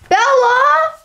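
calling out. Acted. Calling out to friend.

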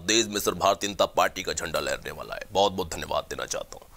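low, soft measured politician speech; deep, steady address to the nation; Rajnath Singh voice. Acted. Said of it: "Rajnath Singh जी की भी आवाज़ निकालता हूँ".

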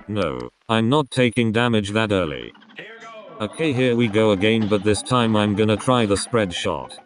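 normal talking voice

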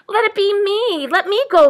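high, loud pleading fawn; volunteer keenly as a young deer; eager fawn. A narrator's reading. Fawn begs to go first, high and eager. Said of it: "volunteered the fawn".

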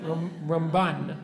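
ramBAN, slow, leaning on the end; stress on second syllable. Shown as a demonstration. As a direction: restart on Ram, then stretch the BAN of Ramban. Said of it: "that's how we say it".

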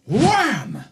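high, falling "wham" effect; big drawn-out cartoon wham; imitated impact noise. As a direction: call out a comic crash sound. Sound effects while waiting.

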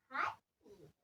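hadi dropped low and soft; muttered. Real, not acted. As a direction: let the second hadi sink under your breath. Urging, then dropping off.

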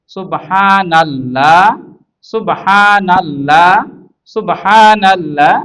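very slow, stretched long vowels; each long syllable held evenly; drawn-out recitation drill. Shown as a demonstration. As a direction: recite সুবহানাল্লাহ slowly for learners to copy. Teacher models the joined word slowly.

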